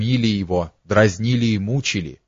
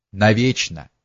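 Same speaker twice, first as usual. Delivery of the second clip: ordinary delivery, nothing marked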